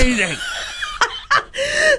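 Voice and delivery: helpless high laughter; high squealing laugh. Real, not acted. Host cracking up at the story, high squealing laugh.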